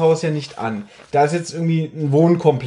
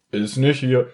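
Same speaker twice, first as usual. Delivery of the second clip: dry deadpan; 4 semitones lower than usual. Retold or imitated. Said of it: "antwortet so ganz trocken".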